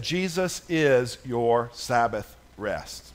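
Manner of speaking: low, slow and soft; hushed, slow voice of God; solemn divine word. Retold or imitated. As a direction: speak it softly as a revelation. Quoting the Spirit of God.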